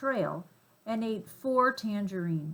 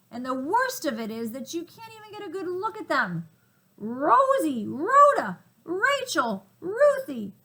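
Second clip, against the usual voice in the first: raging gorilla calling monkeys; 8 semitones higher, 8 dB louder than usual. Acted.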